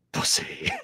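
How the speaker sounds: voiceless monster jeer; Venom. Acted. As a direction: sneer at the cowardly host in a creature whisper. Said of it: "when Venom told him to jump off a building".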